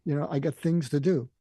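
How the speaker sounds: loud, curt mock brush-off, hurrying the man away; mock a transactional guy who wants to end the call; loud, impatient fake sympathy, then dismissal; dismissive brush-off. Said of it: "I'm not gonna slam you. I'm not gonna pivot to".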